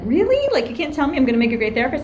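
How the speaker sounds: high, disbelieving protest, quickly; incredulous student. Retold or imitated. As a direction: imitate a student who can't believe it. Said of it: "they look at me like".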